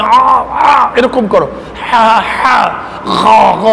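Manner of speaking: আ আ হা হা গ গ croaked from the throat like a crow; rough throaty আ and গ sounds like a crow. Shown as a demonstration. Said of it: "ওই যে কাকের মতো আওয়াজ". (কাকের মতো গলা দিয়ে আ আ হা হা গ গ আওয়াজ)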